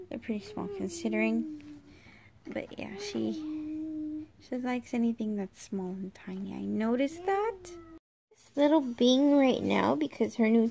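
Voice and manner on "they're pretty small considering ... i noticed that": soft unvoiced whisper. Real, not acted. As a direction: whisper it as an aside. Aside about the toddler.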